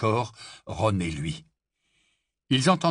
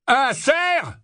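high, incredulous roar; 5 dB louder than usual. A narrator's reading. Barman scorning the Death Eater's claim, roaring. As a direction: roar it back as a question. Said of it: "rugit le barman".

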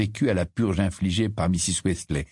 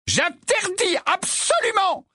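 furious mother forbidding; slower than usual. A narrator's reading.

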